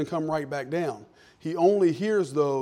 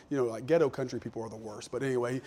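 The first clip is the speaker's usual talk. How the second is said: ordinary talk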